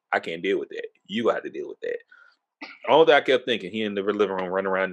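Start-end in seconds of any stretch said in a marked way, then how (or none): 0.1-2.0 a hint of a lower, softer friend backing off; low, quiet refusal to handle it; mild imitation of a reluctant friend; lightly voiced homeboy. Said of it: "He like".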